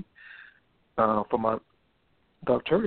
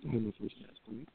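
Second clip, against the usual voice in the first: distant speakerphone caller; muffled, like over speakerphone; 5 dB quieter, faster than usual. Real, not acted. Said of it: "it's like you're on speakerphone".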